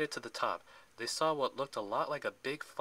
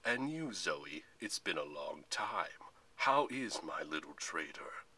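slow, low villain voice; gloating general; 6 semitones lower, slower than usual. A narrator's reading. The general greets his traitor Zoe, gloating. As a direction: sink lower and drag the words out.

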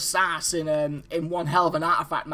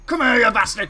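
angry shout; 7 dB louder than usual. Real, not acted. Catching up to target.

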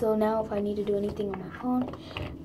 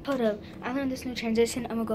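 ordinary talk

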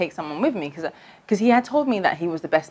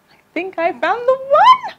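high, slow, swooning and much louder; 7 dB louder than usual. Retold or imitated. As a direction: sigh it out like you've fallen in love. Swooning repeat of the line.

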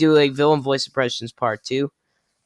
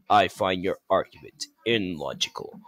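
low, soft logical robot; Shockwave; 6 semitones lower, 5 dB quieter than usual. Acted. Shockwave dismisses an argument, low soft villain. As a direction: lower the voice, cool and measured. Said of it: "and Shockwave".

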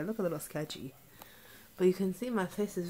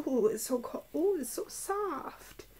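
cooing delight; 7 semitones higher than usual. Pretend. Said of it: "you're like".

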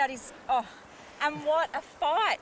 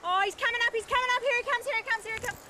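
fighting a fish; 5 semitones higher, 3 dB louder than usual. Real, not acted. Mum fighting big fish.

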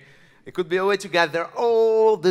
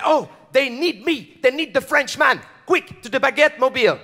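higher, urgent hero's exclamation; excited hero springing to action; 6 semitones higher, 3 dB louder than usual. Acted. French superhero spotting the beret signal, high excited call to action. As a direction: pitch it up like a hero summoned.

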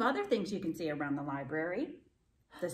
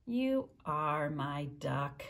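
tender; 5 semitones lower, much slower than usual. Acted. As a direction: savour it gently. Tender conclusion of story.

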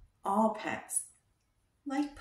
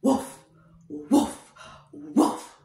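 lower, louder woofs; big-dog imitation for kids; deep dog woof; 8 semitones lower, 6 dB louder, much slower than usual. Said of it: "some dogs say".

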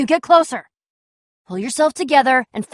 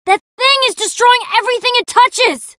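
high-pitched, panicked exclamation; alarmed yell; 9 semitones higher than usual. Acted. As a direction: cry out in alarm at a monster. Alarm at a rampaging monster.

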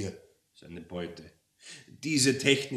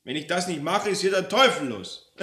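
higher, louder harried official; exasperated devil; 8 semitones higher, 8 dB louder than usual. Acted. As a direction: insist on the rules, fed up.

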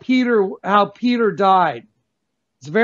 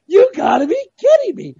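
high, cracking cry of disbelief; disbelieving shout; 11 semitones higher, 3 dB louder than usual. Retold or imitated. Retelling his shock at the serpent sidewalk, a high disbelieving shout. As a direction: yelp it out as if you can't believe your eyes. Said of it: "I was like".

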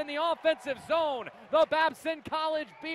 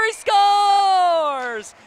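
drawn-out shouted goal call; long goal yell; 4 semitones higher, 11 dB louder than usual. Real, not acted. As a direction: stretch scores long and loud. Goal call loud held.

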